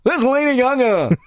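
high radio presenter voice, slowing; cheery breakfast-show announcing. Retold or imitated. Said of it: "It's the D J voice that I had to do".

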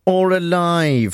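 slow, dramatic game show reveal; drawn-out game show tease. Shown as a demonstration. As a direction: stretch it out like a quiz host. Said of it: "a game of Dead".

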